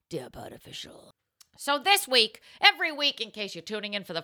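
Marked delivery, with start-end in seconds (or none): 0.1-1.1 soft whispered tag-on. Pretend. Host whispers the web address at the end of the plug.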